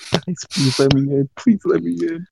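high, whiny retold begging; hammed-up plea; mock pleading. Begging staff to let him in.

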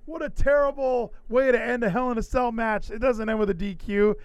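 high, loud complaining; hammed-up fan meltdown, high and loud; mock outraged fan. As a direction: yell it like a whiny fan at the screen. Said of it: "And then he, you know".

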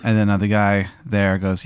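ordinary talk, nothing marked

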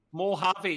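"Mojave" as moh-HAH-vee, silent J; demonstrating "Mojave" with an H sound where the J is; correct pronunciation. Shown as a demonstration. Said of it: "You don't pronounce the J".

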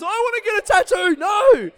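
very high, very loud fan shriek; mock wailing scream. Podcaster mimicking the first girl who missed her chance, mock wailing scream. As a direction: scream it as an over-the-top wail of regret.

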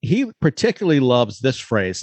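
normal talking voice

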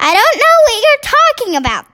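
a touch of a testy king, quick; clipped royal irritation, lightly voiced; testy growl. King snapping testily at the queen, mock growl. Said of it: "growling testily".

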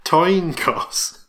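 "Toin cost" with swapped first sounds, T then K; mocking echoed slip. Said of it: "Did you mean to say toin cost".

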